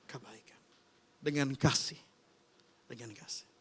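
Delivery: breathy whispered close to the point; hushed whisper. Acted. Preacher closing his point for the congregation, whispering. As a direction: let it fall to a hush.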